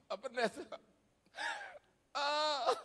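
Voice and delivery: high, loud mock wailing; hammed-up bereaved sobbing; imitated crying. Preacher imitating someone who lost their father, wailing. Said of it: "orang yang nangis gitu".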